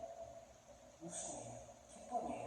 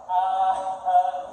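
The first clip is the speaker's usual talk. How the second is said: saying ahh; 9 dB louder, much slower than usual. Shown as a demonstration. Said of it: "아 해보세요".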